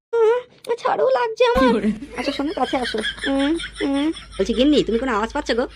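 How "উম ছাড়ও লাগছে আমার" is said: very high, drawn-out coy protest; coy wife. Acted. Wife pushing her husband away, in a very high, slow coy voice. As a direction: stretch it out high like a shy wife pushing him off.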